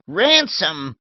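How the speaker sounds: Ransom snapped loud and short; old lady snapping back Ransom indignantly. Acted. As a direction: spit out Ransom in scorn. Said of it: "Miss Letitia snapped".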